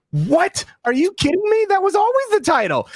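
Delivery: high-pitched mock disbelief; playful, high, incredulous protest. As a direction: squeak out the disbelief as he retells it. Retold incredulous reply to agent.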